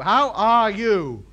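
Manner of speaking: slowed-down How are you? for someone who can't follow; very slow and deliberate. Shown as a demonstration. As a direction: spell out How are you? slowly for a foreigner. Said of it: "No, no. How are you?".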